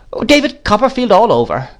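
high, scornful great-aunt cry; exclaiming aunt. A narrator's reading. Miss Betsy cries out about David, exasperated. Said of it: "cried Miss Betsy".

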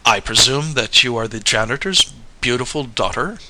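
plain reading voice

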